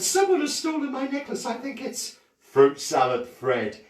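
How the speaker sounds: a touch of a distressed posh lady; lightly voiced upset lady. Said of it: "She said".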